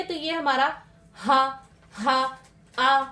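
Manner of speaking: slow, stretched हा held from the throat; teacher stretching हा for students; drawn-out sound demo. Shown as a demonstration. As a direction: hold हा long to show its place. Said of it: "ये हमारा क्या निकल रहा है".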